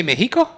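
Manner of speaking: "Mexico" as "MEH-hee-ko"; questioning "Mexico" with a Spanish h; Spanish pronunciation. Shown as a demonstration. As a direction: breathe an h for the x in "Mexico". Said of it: "How are you supposed to say".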